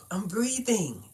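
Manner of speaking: Brooklyn-style breathing, mild; lightly done Brooklyn accent. Shown as a demonstration. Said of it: "That's how them people in Brooklyn talk breathing".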